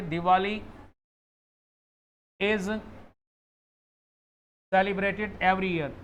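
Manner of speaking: "Diwali is celebrated every year" said very slowly, word by word; slow correct example. Shown as a demonstration. Teacher slowly reads the correct sentence to students. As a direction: lay out the right sentence slowly for contrast. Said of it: "और एक मैंने कहा कि".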